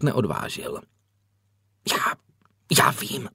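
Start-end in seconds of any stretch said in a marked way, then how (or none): 1.9-3.3 hesitant, halting whisper. A narrator's reading. Boy answering himself by the roadside, whispered. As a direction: whisper it, breaking off after the first word. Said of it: "zašeptal chlapec".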